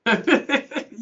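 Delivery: high burst of laughter. Real, not acted. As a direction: laugh out loud at the joke. Laughing at Terry W.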